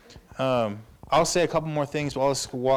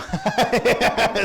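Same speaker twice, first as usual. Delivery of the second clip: laughing; 11 semitones higher, 7 dB louder than usual. Real, not acted. Laughing at the grandma story.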